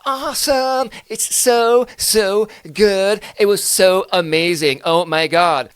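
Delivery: hammed-up American enthusiasm; gushing American. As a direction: squeal like a thrilled typical American. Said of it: "American is like".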